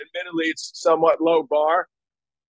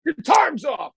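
high-pitched angry yell, cutting the song dead; high, outraged retold scream; screaming bandmate; 12 semitones higher than usual. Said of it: "he'd scream at me".

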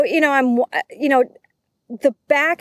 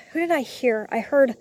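normal talking voice

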